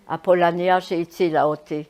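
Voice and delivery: usual speaking voice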